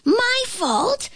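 very high, incredulous question; indignant flea. A narrator's reading. Flea blamed by the ant, high indignant squeak. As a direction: squeak it back, affronted. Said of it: "asked the flea".

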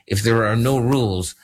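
ordinary talk, nothing marked

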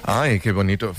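usual speaking voice

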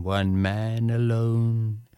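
slow, lilting poetry reading; recited poetry, unhurried and musical; poem recitation. Acted. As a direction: read it like a bard with the Irish refrain. Recites Yeats poem to audience.

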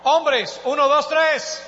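loud, high-pitched count-in; rallying shout. Real, not acted. Preacher cues the men in the congregation, rallying shout. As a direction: call a crowd to answer on three.

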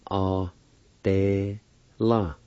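"A, de, la" as three separate, held syllables; syllable-by-syllable pronunciation. Shown as a demonstration. Teacher showing learners the first part, "A, de, la" syllable by syllable. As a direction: sound out "A, de, la" with gaps between. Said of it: "First part is pronounced like this".

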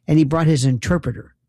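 ordinary talk

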